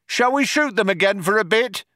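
high, projected suggestion; cop shouting to partner. A narrator's reading. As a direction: call it over as a casual proposal. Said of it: "One cop shouted to the other".

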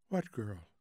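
soft, low, drowsy murmur; almost asleep. A narrator's reading. Wife answering husband in bed, sleepy and faint. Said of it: "She was almost asleep".

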